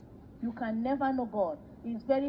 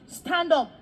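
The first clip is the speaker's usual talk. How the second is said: loud, higher order; commanding call; 4 semitones higher, 6 dB louder than usual. Retold or imitated. Man boasting to Daddy by raising his preachers, commanding call.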